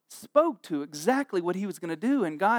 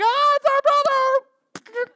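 high, loud, drawn-out shriek; shocked brothers; 6 dB louder, slower than usual. Retold or imitated. Brothers seeing Joseph unmasked, high drawn-out shriek. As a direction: scream like the mask just came off. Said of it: "and he's like".

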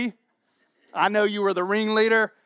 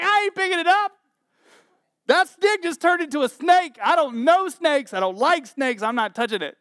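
high, louder panicky refusal; scared Moses; 6 semitones higher, 4 dB louder than usual. Retold or imitated. Moses refusing God, high panicked protest. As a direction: back away from the snake in your voice. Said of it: "He's like".

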